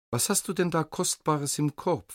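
pressing, eager king's question; impatient. A narrator's reading. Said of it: "fragte der König ungeduldig".

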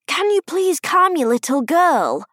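irritated theatre-goer; annoyed man. A narrator's reading. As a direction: complain testily to the person in front. Annoyed man behind complains.

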